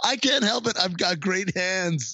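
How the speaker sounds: proud and dopey, stretched out; goofy boast. Retold or imitated. Charlie Day excusing himself, high goofy boast. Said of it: "He's like".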